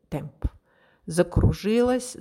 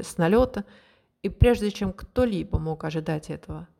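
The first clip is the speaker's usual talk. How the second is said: plain reading voice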